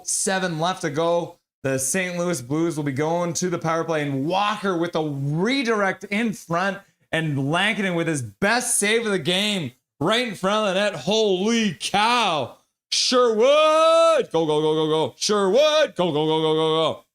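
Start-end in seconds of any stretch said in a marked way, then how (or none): 1.6-12.6 play-by-play swelling into amazement; excited live hockey call, building; building excitement. Real, not acted. Live call of a big save. 12.9-17.0 real goal celebration. Real, not acted. Calling a goal as it happens.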